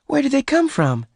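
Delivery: amazed question. Acted. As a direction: Bess asks in surprise. Bess asks, amazed.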